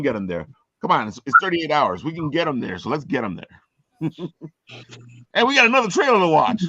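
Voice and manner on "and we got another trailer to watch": high, loud, fast hype shout; excited. Real, not acted. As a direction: fire up the audience for the trailer. Host hyping next trailer.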